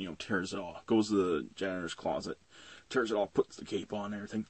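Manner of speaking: ordinary talk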